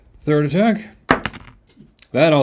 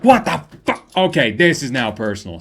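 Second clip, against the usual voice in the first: outraged enemy; 5 dB louder than usual. Pretend. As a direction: yell it, then turn grimly personal. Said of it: "Atu now is just like".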